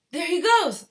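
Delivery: high, loud excited shout. Acted. Onlooker shouting as the boy shoots up. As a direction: point and shout. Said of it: "somebody shouted".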